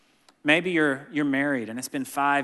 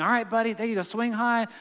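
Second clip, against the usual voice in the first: cheering on; 8 semitones higher than usual. Real, not acted. Cheering son at t-ball.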